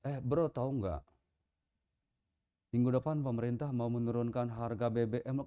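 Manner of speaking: good news told with a gloomy face; glum wrong delivery. Retold or imitated. Preacher showing good news wrongly told in a mournful tone. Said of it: "bahasa tubuh kita".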